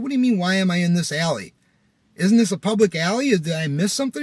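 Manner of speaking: high, loud indignant questioning; worked up. Retold or imitated. Driver challenged about being in the alley, worked up. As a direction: retell an argument, challenging and heated. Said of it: "I'm getting worked up".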